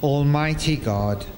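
usual speaking voice

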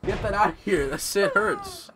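loud, high yelps of real pain; pained yelling. Real, not acted. Gamer hit by a thrown toy, yelling in pain. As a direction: shout it out like the hit really stung. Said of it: "that fucking hurts".

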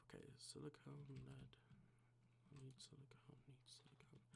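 whispered to himself while searching. Real, not acted. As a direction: breathe the words, barely voiced.

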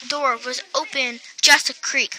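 ordinary delivery

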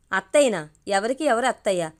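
ordinary delivery, nothing marked

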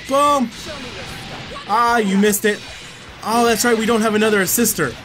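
ordinary talk, nothing marked

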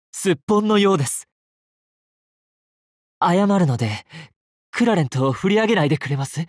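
high, quick placating plea; alarmed backpedalling. Acted. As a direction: talk fast and high to avert a blow. Teasing knight threatened with a sword.

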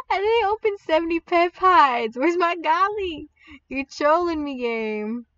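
high, playful protest; mock-outraged whine. Player to the trolling game, whiny protest. Complaining to game about no Godly.

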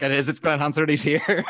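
hope surging back; thrilled. Retold or imitated. Spotting his hero three rows ahead, thrilled and loud. Second ginger guy sits down.

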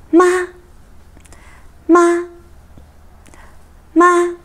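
"吗" short and level, pitched high here; neutral tone. Shown as a demonstration. Teacher drilling the question particle, short neutral tone. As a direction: give each "吗" one brief, unstretched beat. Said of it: "a question particle and a neutral tone".

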